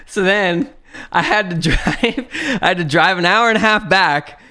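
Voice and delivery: real laughter breaking in; laughing while telling. Real, not acted. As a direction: laugh at the absurdity. Laughing at own misfortune.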